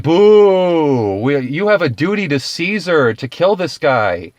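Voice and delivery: high, loud booing mob; mock crowd jeer.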